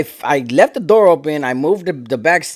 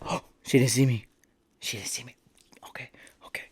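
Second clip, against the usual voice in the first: hushed retold whisper, breath only; 5 semitones lower, 10 dB quieter than usual. Hiding behind the counter, whispering to himself. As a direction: whisper to himself so the clerk won't hear. Ducking hidden from the clerk.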